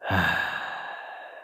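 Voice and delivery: long, unvoiced-sounding breathy sigh; exasperated sigh. Villain facing a stubborn captive, exasperated sigh.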